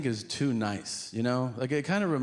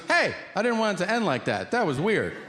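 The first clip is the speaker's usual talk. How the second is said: calling out; 7 semitones higher, 3 dB louder than usual. Retold or imitated. Senator calling out again.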